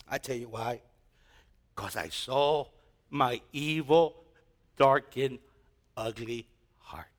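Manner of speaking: soft, slow, sinking to a whisper; hushed confession. Real, not acted. Preacher confesses his dark heart to the church, sinking to a whisper.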